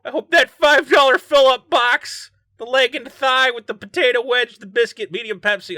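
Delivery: high, loud jeering cop voice, sneering at a suspect; mocking cop. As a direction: cop sneering at the suspect over his chicken receipt, jeering loudly. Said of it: "being like".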